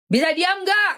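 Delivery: angry judge. Retold or imitated. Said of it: "Kata tuh".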